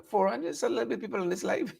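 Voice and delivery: mock viewer complaint. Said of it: "I know some of you are saying".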